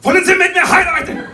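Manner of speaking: high, very loud mock-German bark; explosive, menacing yell; shouted German proposal. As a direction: scream the proposal like a military order. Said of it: "in German that is".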